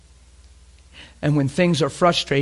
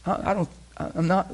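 usual speaking voice

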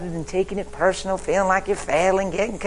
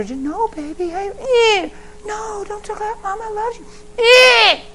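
sweet tender mama; 8 dB louder, slower than usual. Retold or imitated. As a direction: coo gently at a baby. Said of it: "all the mama is, is sweet and tender".

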